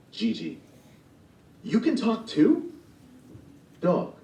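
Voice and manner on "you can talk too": high, astonished question; can't believe the dog talks too; surprised. Acted. Gigi amazed the dog talks as well, surprised.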